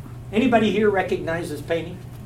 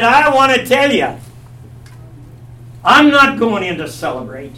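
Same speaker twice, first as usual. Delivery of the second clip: angry refusal; 3 semitones higher, 6 dB louder than usual. Acted. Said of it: "He got mad".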